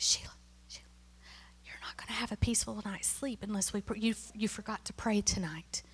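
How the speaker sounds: soft unvoiced whisper nudging a sleepy roommate; mock whisper. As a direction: whisper it so nobody else wakes. Friend nudges her at night.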